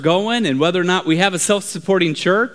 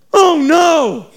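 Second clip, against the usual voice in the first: high cry of dread; alarmed lookout; 8 semitones higher, 4 dB louder than usual. Acted. Lookout sees something worse, alarmed. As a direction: cry out in mock horror. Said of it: "he began to scream".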